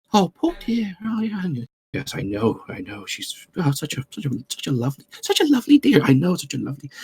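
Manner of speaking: a hint of a gossiping old woman; lightly voiced old gossip, hushed; gossiping old lady. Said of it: "gossiping old people".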